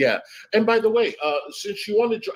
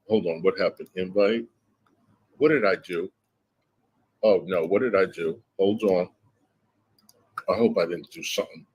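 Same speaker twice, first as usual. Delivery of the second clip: mock worried; 9 semitones lower than usual.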